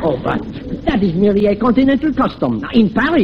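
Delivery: put-on protesting in phony French accent. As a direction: explain it away with a French accent. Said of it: "talking like this with a French accent".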